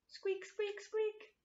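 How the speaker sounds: very high tiny mouse squeak; high mouse voice for the children; squeaky mouse. Acted. Mouse sound in garden rhyme.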